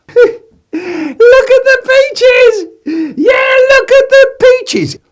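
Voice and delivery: very high slow laughing cry; laughing shriek. Acted. Man shrieking at peaches, high laughing. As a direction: howl with delight.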